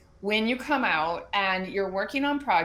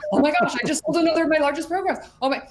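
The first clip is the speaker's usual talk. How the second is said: fast, high, loud gushing; mock giddy client, high and loud; excited client; 7 semitones higher, 6 dB louder, faster than usual. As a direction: rattle it off like a thrilled client. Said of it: "She's like".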